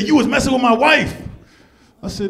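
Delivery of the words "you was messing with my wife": high, loud accusing bark; hammed-up jealous husband confronting him; angry big dude.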